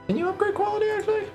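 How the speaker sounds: squeaky high question; high-pitched. Real, not acted. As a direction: pitch way up in surprise. Wonders at the item menu.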